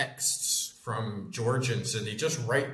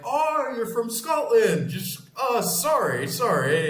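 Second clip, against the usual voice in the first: much higher, slow, apologetic put-on voice; higher, slow fawning waiter apologising to the Scot; 10 semitones higher, 4 dB louder than usual. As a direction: gush an apology like a suddenly sweet waiter. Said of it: "they're like".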